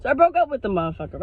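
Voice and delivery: ordinary delivery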